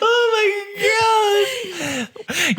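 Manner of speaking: slow, drawn-out high wail; zombie noise. Pretend. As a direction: groan it out like a zombie.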